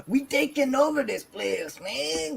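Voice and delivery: tough New York squirrel. Pretend. Voicing rebel squirrels to a friend, mock New York accent. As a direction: play a streetwise New York squirrel taking over. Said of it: "assuming the squirrels have an accent".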